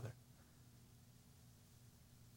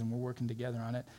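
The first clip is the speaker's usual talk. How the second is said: ordinary talk, nothing marked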